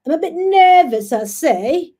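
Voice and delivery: high, loud mock-British accent; bad British accent. Host jokes to viewers about nerves, hammed-up British accent. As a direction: put on a badly faked British accent. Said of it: "my, my, my British accent is so horrible".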